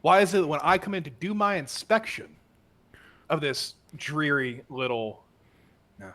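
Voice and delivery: berating inspector. Acted. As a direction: tear into him for his men's state. Inspector berating captain's neglect.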